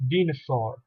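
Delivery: "dinosaur" said the French way, DEE-no-sor; French pronunciation. Shown as a demonstration. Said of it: "Which means the dinosaur".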